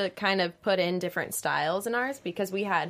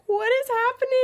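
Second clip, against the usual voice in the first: high, loud squeal of overwhelm; mock bawling disbelief; 5 dB louder than usual. Dancer retelling getting her ticket, mock tearful squeal. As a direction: squeal it as if bursting into happy tears, hammed up. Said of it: "Just bawling my eyes out".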